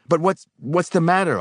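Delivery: high, loud, stumbling question; bewildered stammer. A narrator's reading. Helper baffled by the old man's rage, stammering. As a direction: stumble over it, pitch jumping up. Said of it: "I stammered".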